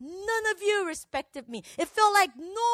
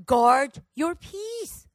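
slow, measured gentle command; loving rebuke; 3 semitones lower, 4 dB louder, much slower than usual. Acted. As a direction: admonish lovingly, word by word. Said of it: "loving rebuke".

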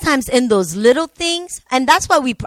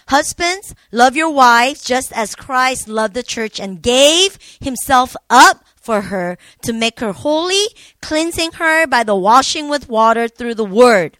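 louder, impassioned scripture reading with slowed emphasis; passionate reading; 5 dB louder than usual. Real, not acted. Wife reads to the congregation the verses on husbands' duty, with passion. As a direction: read it with real conviction, pushing the volume. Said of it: "she read that with more passion".

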